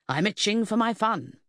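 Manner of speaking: complain, itching to get on with it. A narrator's reading. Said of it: "the bootmaker complained".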